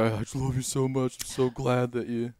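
lightly voiced sentimental drunk; mock drunk. Drunk dad phoning his son, mock gushing. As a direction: gush like a drunk caller. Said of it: "you get the drunk calls".

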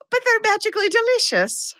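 high cartoon leprechaun ad voice; sing-song cereal mascot. Host jokes about Lucky Charms as the leprechaun mascot.

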